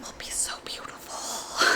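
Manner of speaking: soft breathy whisper to herself; hushed, almost to herself. Real, not acted. Whispered aside over the rolls.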